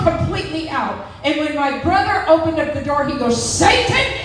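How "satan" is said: shouted rebuke. Retold or imitated. Brother casting out a demon, high shouted command. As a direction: yell to drive the devil out.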